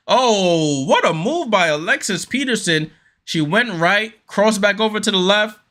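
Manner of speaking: thrilled commentator call; excited call. Real, not acted. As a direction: sell the highlight with energy. Commentator hypes a crossover move.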